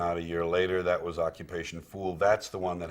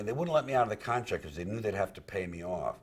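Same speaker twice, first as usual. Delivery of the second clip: ordinary talk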